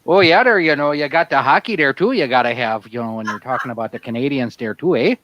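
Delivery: put-on thick Milwaukee south-side accent with "there" and "eh"; Wisconsin accent. [name] riffing on Canadians for friends, Wisconsin accent. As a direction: lay on the upper-Midwest Wisconsin twang. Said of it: "that's sounds more like".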